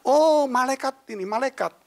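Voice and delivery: high, loud "oh" of discovery; amazed realisation. Retold or imitated. Dreamer realises the visitor is an angel, acted amazement. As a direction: act out a sudden awed realisation.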